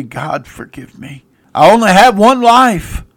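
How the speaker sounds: loud, fast pleading to God; fervent prayer. Acted. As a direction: pray it with urgency. Preacher voices a prayer.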